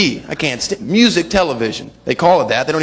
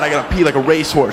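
ordinary talk, nothing marked

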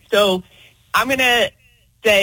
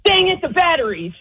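higher, lightly annoyed "Dang it"; playful frustrated exclamation; 7 semitones higher than usual. Shown as a demonstration. Listener shows the host how to say it: playful exasperation. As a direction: toss it off like a playful groan. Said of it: "what do you say and how do you say it".

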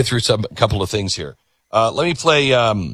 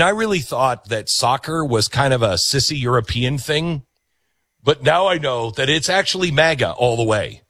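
ordinary talk, nothing marked

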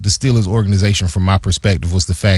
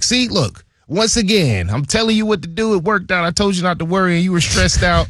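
much higher, teasing I-told-you-so voice; mock GM voice; 11 semitones higher than usual. Player imitating his GM gloating over the contract, high and teasing. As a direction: pitch it way up and gloat like the GM.

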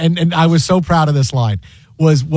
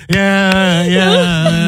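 drawn-out cartoon-character "yeah"; Squidward. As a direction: lightly imitate a cartoon grump, stretching each word. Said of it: "And Squidward goes".

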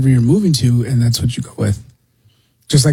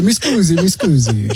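high mock Italian mi scusi; a light caricature of an Italian woman; 4 semitones higher than usual. Cohost teases with Italian phrase, light mock accent. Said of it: "She didn't throw the".

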